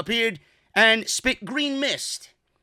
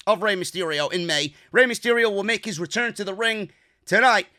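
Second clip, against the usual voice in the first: ordinary talk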